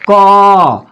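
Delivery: "क" held long on its vowel; drawn-out pronunciation. Shown as a demonstration. Teacher showing students how the consonant is pronounced, drawn out "क". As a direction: sound out "क" slowly, stretching the vowel. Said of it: "व्यंजन वर्ण का जब भी उच्चारण होता है".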